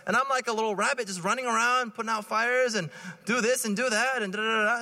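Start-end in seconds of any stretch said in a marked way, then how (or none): none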